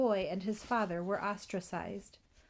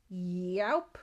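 drawn-out yowp sliding upward; rising cartoon gobble noise; giant's gulp; 2 semitones lower than usual. A narrator's reading. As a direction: imitate a monster swallowing, swooping up. Imitates giant gulping down sheep.

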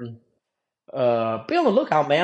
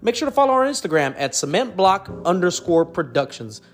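upbeat, louder promo outro; outro promo read; 4 semitones higher, 3 dB louder than usual. Acted. Host urging viewers to subscribe, in an upbeat promo read. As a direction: pitch the channel like an announcer.

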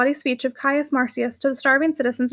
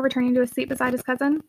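ordinary delivery

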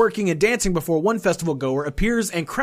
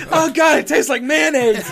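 laughing mock delight; 9 semitones higher, 4 dB louder than usual. Pretend festival goer tasting milk.

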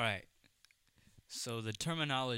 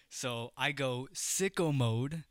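normal talking voice

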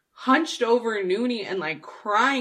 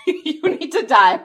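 laughing it out, a bit higher; giggling dark punchline; laughing repeat; 6 semitones higher, 4 dB louder than usual. Real, not acted. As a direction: say it again while cracking up.

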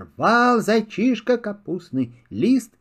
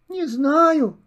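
small kitten voice; 9 semitones higher than usual. Acted. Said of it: "отвечает котёнок".

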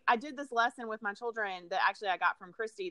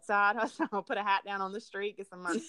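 ordinary talk, nothing marked

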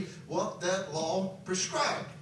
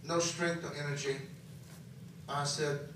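usual speaking voice

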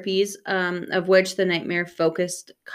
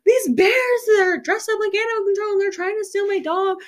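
frantic panicked call; 12 semitones higher than usual. Retold or imitated. Panicked phone call in dream.

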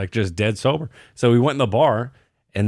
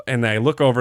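usual speaking voice

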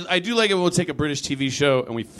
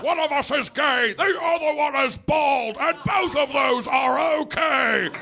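high, slow, booming British stage voice; posh British actors; 10 semitones higher than usual. Retold or imitated. Stewart and McKellen introducing themselves, slow British stage boom. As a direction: project it like a grand old Shakespearean. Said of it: "They're like".

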